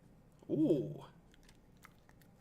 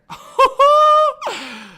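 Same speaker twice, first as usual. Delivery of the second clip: loud, very high hooting laugh; high whooping laugh; 9 dB louder than usual. Real, not acted. Livestreamer reacts to trade frenzy.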